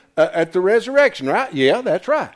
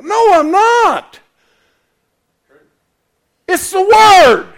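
slow, higher, louder denial with a cry in it; fervent protest; 12 semitones higher, 8 dB louder, slower than usual. Real, not acted. Preacher denying he is political, slow fervent cry.

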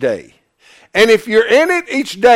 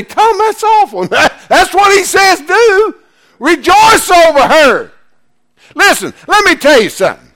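high-pitched, forceful preaching; impassioned preaching; 9 semitones higher, 5 dB louder than usual. Real, not acted. Preacher answering an objection from the congregation, impassioned. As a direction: drive it home high and insistent.

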